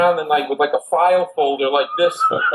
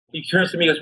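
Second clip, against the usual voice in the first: ordinary talk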